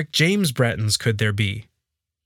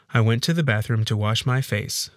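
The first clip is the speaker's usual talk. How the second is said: usual speaking voice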